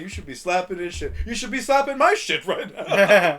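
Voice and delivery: high, loud mocking taunt full of laughter; laughing taunt. Bobby mocks Jack for believing the fool, laughing. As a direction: needle him while cracking up. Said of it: "Bobby's just laughing at him".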